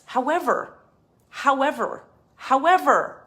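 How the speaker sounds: escalating emphatic however; building however. Real, not acted. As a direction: hammer the word however, rising to a near shout.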